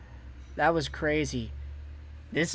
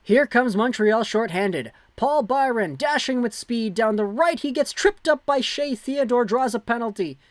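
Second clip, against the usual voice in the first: rising play-by-play; 5 semitones higher than usual. Acted. Live play-by-play on shorthanded rush.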